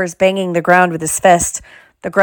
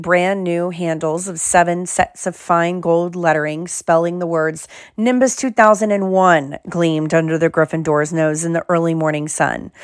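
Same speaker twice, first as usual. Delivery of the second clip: usual speaking voice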